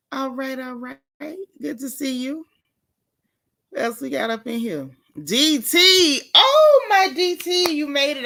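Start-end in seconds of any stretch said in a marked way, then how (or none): 5.2-8.2 Oh shot way up, much higher and louder; a squeal of delight as a friend shows up; excited greeting. Real, not acted. Long-missed friend arrives in chat.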